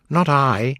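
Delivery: ordinary delivery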